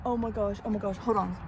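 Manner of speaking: ordinary delivery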